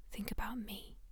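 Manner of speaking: soft unvoiced whisper. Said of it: "she whispered".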